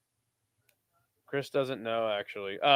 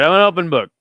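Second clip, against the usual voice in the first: much louder I'm an open book, punched out; loud declaration; 3 semitones higher, 12 dB louder than usual. Real, not acted. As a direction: shout it to the chat. Repeats the phrase twice.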